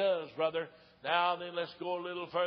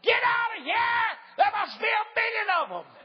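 panicked hollering; 7 dB louder than usual. Retold or imitated. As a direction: scream it like a guard running for his life. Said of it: "went to hollering".

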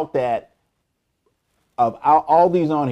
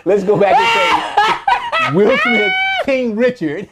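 laughing; 4 dB louder, slower than usual. Real, not acted. Laughing correction after slip.

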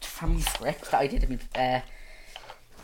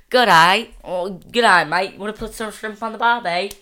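loud, high Aussie accent; hammed-up Australian accent, big and loud; 5 semitones higher, 10 dB louder than usual. Shown as a demonstration. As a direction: call it out like a cartoon Australian.

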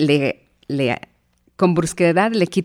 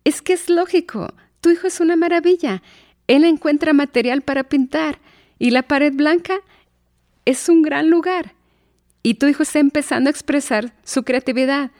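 much higher, gushing voice of an enthusiastic artist; delighted artsy friend; 9 semitones higher than usual. Retold or imitated. The artistic friend praises the child's wall painting, high and gushing. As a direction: gush over the painted wall like a thrilled art lover. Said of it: "y que mi amiga dice".

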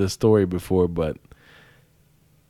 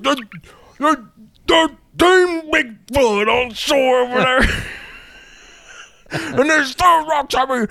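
much higher hillbilly shout, breaking into laughs; yelling uncle. Retold or imitated. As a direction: holler it like a panicked uncle on a boat. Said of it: "he's like".

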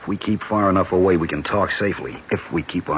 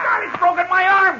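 pained cry. Acted. Prisoner yelling in pain after his arm is twisted.

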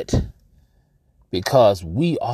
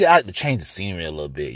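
usual speaking voice

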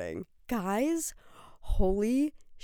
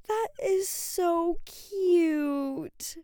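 high giggle then slow gushing; giddy laugh; 10 semitones higher, much slower than usual. Real, not acted. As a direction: melt over how cute it is. Reacting to comment.

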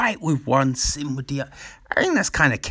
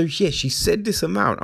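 usual speaking voice

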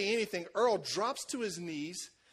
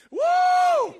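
very high, very loud woo; shouted woo; 13 dB louder than usual. Retold or imitated. Preacher can't help shouting a high woo mid-prayer. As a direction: whoop it out at the top. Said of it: "And I'm like".

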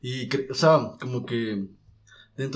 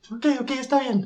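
very high resigned squeak; squeaky high voice; 10 semitones higher than usual. Retold or imitated. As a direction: agree grudgingly, tiny high voice. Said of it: "Y el man dice". (voz aguda resignada)

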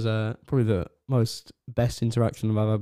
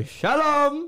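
high-pitched drawn-out hail; hammed-up call, pitched way up; 14 semitones higher than usual. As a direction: sing out a greeting from the car. Acting friends calling from car.

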